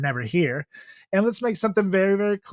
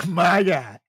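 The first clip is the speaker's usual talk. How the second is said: normal talking voice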